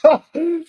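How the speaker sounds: a short, high burst of laughter. Real, not acted. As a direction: cracks up at the joke. Reacts to camel comment.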